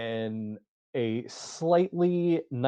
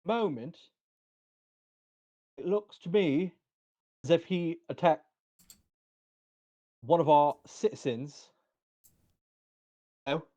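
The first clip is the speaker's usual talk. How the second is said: higher-pitched accusing guard; challenging guard; 5 semitones higher than usual. Acted. Guard accusing Gronk of attacking a citizen, accusing voice. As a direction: question them in a higher, accented guard voice. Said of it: "I don't know why that accent slipped".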